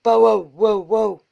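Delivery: loud bow wow barks; dog barking. A narrator's reading. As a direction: bark it out like a dog. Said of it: "said Bitzer Maloney".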